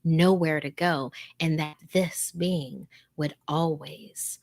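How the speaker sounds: slow, creepy whisper; host savoring the horror, slowly whispered; ominous whisper. Acted. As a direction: let the threat hang in a whisper. Dramatic retelling of story's dread.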